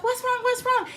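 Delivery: a hint of a worried neighbor; lightly voiced neighbor. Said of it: "She's like".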